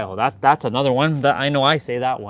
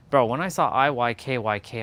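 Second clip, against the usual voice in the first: ordinary talk, nothing marked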